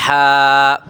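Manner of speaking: ہا breathed hard from the middle of the throat; throat ha demo. Shown as a demonstration. Teacher shows pupils the letter ہا, a strong throaty demo. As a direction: push out ہا like cooling a burning throat. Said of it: "حلق کے درمیان سے کیا ادا ہوتی ہے".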